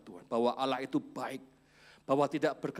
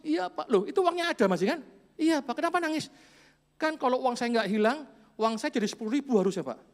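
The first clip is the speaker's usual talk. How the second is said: put-on high, louder childish whine; whiny child voice; 9 semitones higher, 5 dB louder than usual. Child tells the man why he still cries, high whine. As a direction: whine like a greedy kid. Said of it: "child answers the man".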